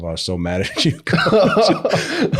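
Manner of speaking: laughs through the player's confession; giggling out so mad at you; laughing quote. Retold or imitated. Coach retells the player admitting he was mad, laughing.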